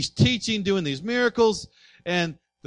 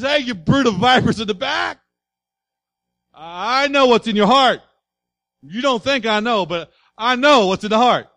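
loud, higher shouted rebuke; 3 semitones higher, 8 dB louder than usual. Acted. Jesus confronting Pharisees who whisper, loud shouted rebuke. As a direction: confront them head-on, full voice.

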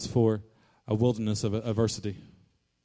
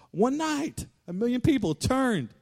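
emphatic exclamation; 11 semitones higher, slower than usual. Real, not acted. Preacher stressing how fast the people turned, high exclamation. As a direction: voice leaping very high. Preacher's climax on the bad report.

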